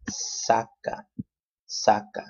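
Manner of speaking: saca said slowly, weight on SA; careful demo of saca, first syllable stressed. Shown as a demonstration. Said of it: "the emphasis is right here on this first A".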